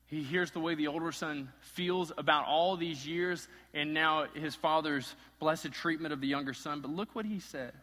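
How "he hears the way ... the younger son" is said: ordinary talk, nothing marked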